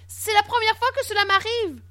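very high, distressed protest; panicky pleading. A narrator's reading. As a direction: plead innocence, voice shooting up. Boy pleads with furious king. (supplication affolée, voix très aiguë)

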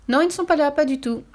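put-on high, loud, chirpy child's answer; little girl. A narrator's reading. Girl telling burglars her parents are away, high child voice.

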